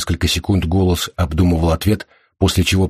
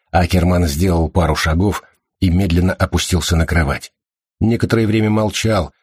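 ordinary delivery

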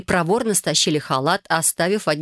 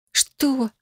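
shocked; 8 semitones higher than usual. A narrator's reading. Heroine shocked by rumour.

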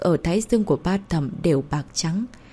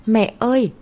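higher-pitched, anxious little call; slightly worried; 4 semitones higher, 4 dB louder than usual. A narrator's reading. Boy sees his mother crying with a stranger, worried call. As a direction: call out for Mum with a touch of worry. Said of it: "Cậu bé có một chút lo lắng gọi".

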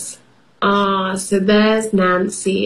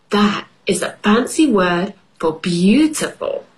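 usual speaking voice

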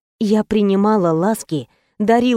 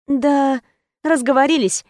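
higher-pitched, noncommittal answer; vague reply; 5 semitones higher than usual. A narrator's reading. Heroine dodging Kristina's question, higher-pitched vague reply. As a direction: answer evasively in a raised pitch. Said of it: "туманно отозвалась я".

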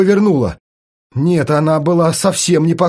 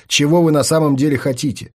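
usual speaking voice

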